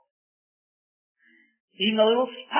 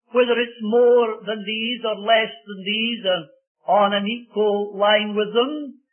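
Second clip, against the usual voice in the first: normal talking voice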